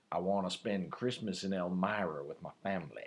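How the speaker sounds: a hint of a classic movie character; It's a Wonderful Life quote. Host quoting a Christmas film to his co-host, low and soft. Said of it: "something from It's a Wonderful Life".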